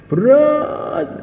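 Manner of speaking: a sudden high, strained "Berat"; anguished cry. Acted. Preacher voicing the companions' burden, high cry. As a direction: cry out the weight of it. Exclaims the heaviness.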